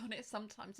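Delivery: ordinary talk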